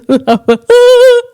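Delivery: high squealing laugh; high startled laugh. Real, not acted. Vlogger laughing at her own startled scream, high squeal. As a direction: let out a shrieking giggle. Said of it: "a sound came out my mouth".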